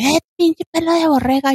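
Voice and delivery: high, jeering insult voice; a stranger mocking her curly hair. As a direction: taunt like a rude stranger. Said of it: "me dijeron".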